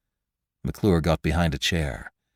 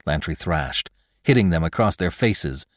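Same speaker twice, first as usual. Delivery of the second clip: ordinary delivery, nothing marked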